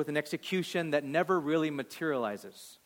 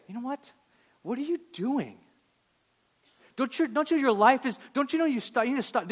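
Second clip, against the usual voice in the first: much higher, exasperated scolding; mock lecture; 11 semitones higher, 6 dB louder than usual. Pastor imagining Jesus scolding the woman, in mock exasperation. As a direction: pile on the nagging rebuke. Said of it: "He doesn't lecture her".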